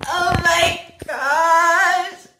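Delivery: real emotional oh my gosh; overwhelmed. Real, not acted. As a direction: stretch it out, overcome with joy. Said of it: "I'm trying so hard not to cry".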